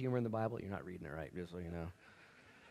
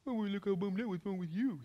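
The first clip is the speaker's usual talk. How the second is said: high, put-on sneering parrot voice; mocking echo; 8 semitones higher than usual. Mad disciple parroting Jesus' question, mocking echo. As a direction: throw the question back in mimicry. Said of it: "This guy's mad".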